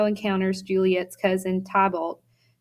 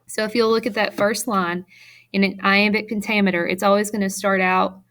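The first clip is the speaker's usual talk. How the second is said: normal talking voice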